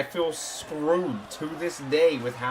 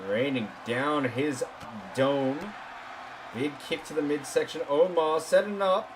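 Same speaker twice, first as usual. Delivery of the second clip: wrestling commentator; 4 semitones lower, 4 dB quieter than usual. Acted.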